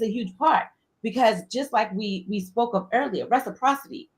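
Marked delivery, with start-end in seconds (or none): none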